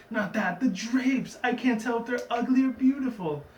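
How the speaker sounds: mock fret over the drapes; a prissy pony fussing over curtains; fussy Rarity. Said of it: "She's like".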